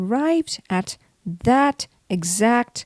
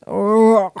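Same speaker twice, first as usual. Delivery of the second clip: a queasy ugh; sick groan; 4 dB louder than usual. A narrator's reading. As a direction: groan like you might be sick. Carsick mouse.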